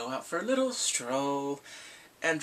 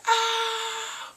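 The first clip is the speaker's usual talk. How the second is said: shrieked "ah" of shock; long, high-pitched squeal of disbelief; high excited scream; 4 dB louder than usual. Acted. Fan hearing Jon finally told the truth, high scream. Re-enacts reaction to the secret being told.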